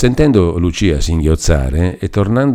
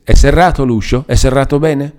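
higher, louder, urgent question about the door; a touch of resolve, pitched higher; more resolute; 8 semitones higher, 4 dB louder than usual. A narrator's reading. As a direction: firmly demand whether it is locked. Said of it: "con più risolutezza".

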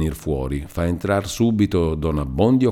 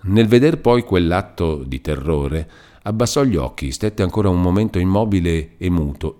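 ordinary delivery

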